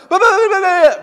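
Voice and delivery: high, loud, rushed babble; a burst of nervous blurting. Pretend. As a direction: gush it all out in a panic. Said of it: "Esther would blurt out".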